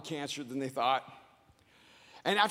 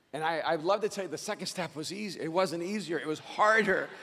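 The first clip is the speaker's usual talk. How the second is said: ordinary delivery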